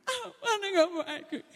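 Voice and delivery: mock a teary child giving in; whiny kid. Spanked son caving to ice cream, teary mock whine.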